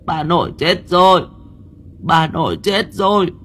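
high, whiny fake sobbing; fake whimpering. Demon children faking grief for the neighbours, fake whimper. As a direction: pretend to cry for the neighbours. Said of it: "khóc thút thít".

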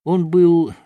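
usual speaking voice

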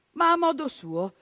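ordinary delivery

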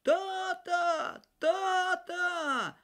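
high, far-carrying cry for father; boy calling out desperately for his father; calling out loud. A narrator's reading. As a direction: call for dad across the woods. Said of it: "Кузьма в крик".

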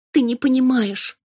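high, loud desperate plea; imploring. A narrator's reading. Robin begging her cousin to understand, desperate. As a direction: beg for understanding. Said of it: "взмолилась я".

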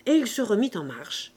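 ordinary delivery

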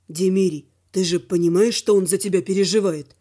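low male voice; earnest, low-pitched appeal; reasoning friend. A narrator's reading. Slava reasons with Velor.

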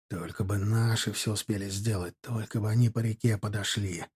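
low, hushed worried thought; anxious inner thought. A narrator's reading. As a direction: think it aloud, soft and low. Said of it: "Будоражило сознание тревожная мысль".

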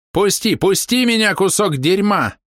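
high, slow desperate yell. A narrator's reading. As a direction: scream it out, struggling. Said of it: "орал незнакомым голосом".

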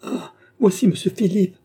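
high, loud cry of dread; terrified cry. A narrator's reading. Nicole sees Philippe arriving alone, crying out in terror. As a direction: cry out in terror, pitched right up. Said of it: "s'écria Nicole avec un accent de terreur".

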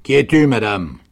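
loud, commanding sergeant's question; sergent qui interroge. A narrator's reading. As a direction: call it out like a sergeant on duty. Sergeant interrogates a frightened woman. (sergent qui interpelle d'une voix forte)

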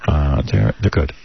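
low, reluctant, unenthused answer; imitated monotone. Actor answering fans' questions at dinner, mimicked monotone. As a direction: mimic a star giving nothing back. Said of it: "Just like that in a monotone".

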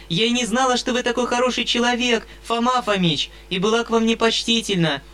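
put-on high-pitched eager girl; eager young girl. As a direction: apologise sweetly and eagerly. Sashenka's girlish apology.

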